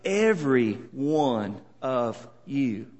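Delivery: EVERY, ONE, OF, YOU each slow and separate; soft, deliberate word-by-word stress on EVERY ONE OF YOU; emphatic. Shown as a demonstration. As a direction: spell out the emphasis in EVERY ONE OF YOU, pausing between words. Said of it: "there's emphasis there".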